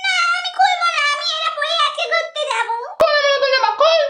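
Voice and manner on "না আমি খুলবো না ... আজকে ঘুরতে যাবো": put-on high, stubborn child's refusal; little sister refusing her elder sister; whiny little sister. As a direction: refuse like a spoilt kid. Sisters quarrel over dress.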